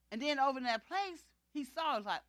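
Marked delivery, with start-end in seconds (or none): none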